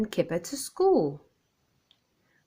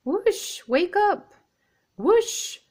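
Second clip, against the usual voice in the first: much higher ringing wake-up call; alarm clock call; 12 semitones higher, 3 dB louder, slower than usual. Acted. Biff's alarm clock goes off as Floppy skids past, high ringing call.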